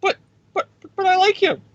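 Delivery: very high, squeaky, pleading voice; Tony begging Dick Cheney on a call, squeaky and high; whiny Tony Stark. Retold or imitated. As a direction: whimper it like a nervous kid. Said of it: "he's on a call with Dick Cheney".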